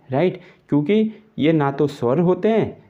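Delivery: usual speaking voice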